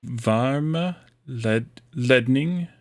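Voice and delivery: very slow "Varma Led-, Ledning" with a rise on "Ledning"; slow Norwegian attempt. Shown as a demonstration. Working on the stressed E the tutor pointed out, slow. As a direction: drag out "Varma Ledning" carefully. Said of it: "the emphasis is on the E".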